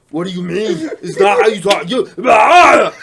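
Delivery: mock Austrian accent building to a yell; Arnold Schwarzenegger. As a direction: argue in an Austrian accent, finish on a strangled bellow. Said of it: "he's gonna be like".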